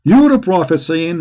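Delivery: ordinary talk, nothing marked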